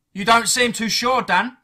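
high, loud challenge; shouting back. A narrator's reading. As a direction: yell over noise to goad him. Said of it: "he shouted back at me".

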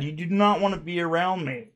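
ordinary delivery

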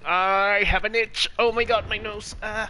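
frantic yelp. Real, not acted. Sudden itch mid-game.

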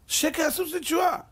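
verse declaimed on a high pitch; emphatic proclamation. Shown as a demonstration. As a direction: proclaim it as the clinching proof. Same verse proclaimed as climax. (הכרזה נחרצת בקול גבוה)

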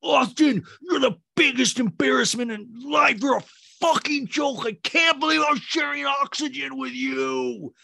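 very high, hammed-up shouting; mock furious yell, last words stretched out; imitated screaming. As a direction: shriek it like an outraged reality star. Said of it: "Why are you screaming at me".

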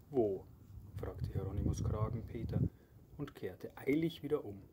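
plain reading voice

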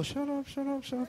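high, soft, rapid-fire pleading chant; squeaky little voice inside the head; irritated inner voice. Acted. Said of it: "In your head, you're like".

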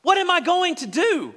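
high, desperate wail of a brother in despair; anguished cry. Acted. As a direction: Reuben crying out, lost and helpless. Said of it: "He said".

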